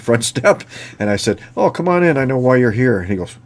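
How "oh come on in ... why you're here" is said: cheery welcome. Retold or imitated. Welcoming officer at the door.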